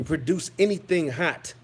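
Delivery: ordinary delivery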